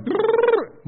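squeaky high-pitched gibberish; high angry babble. Retold or imitated. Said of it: "one goes".

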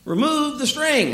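high, coaxing shout as a talking string. A narrator's reading. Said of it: "It shouted".

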